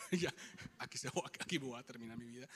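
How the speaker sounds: low and soft, a scared aside; resigned inner thought. Retold or imitated. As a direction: say it low as if life is ending. Fearing death, inner thought. (pensamiento resignado, en voz baja)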